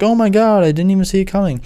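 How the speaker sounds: high, hammed-up gasp of surprise; mock shock. Prank victim reacting to the reveal, hammed-up surprise. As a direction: overplay the stunned victim.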